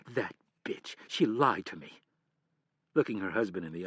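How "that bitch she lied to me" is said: teeth-clenched mild snarl; snarled through clenched teeth. Acted. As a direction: spit it out with contained rage. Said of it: "Through clenched teeth, she snarled".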